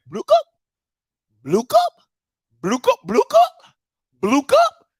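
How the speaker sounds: high sing-song imitation of a phone game; mimicking game sounds. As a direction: chirp it way up like a mobile game jingle. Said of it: "She over there".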